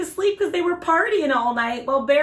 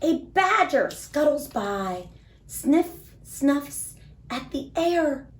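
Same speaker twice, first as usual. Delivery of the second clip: put-on lively, sing-song storybook read for little kids; reading to children; slower than usual. A narrator's reading. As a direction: read it like bedtime story time for small children. Reads picture book to kids.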